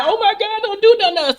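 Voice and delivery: imitating a fake-scared victim begging; mock crying plea. Robbery accomplice fakes crying to the gunman, hammed-up high plea. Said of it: "she get the crying".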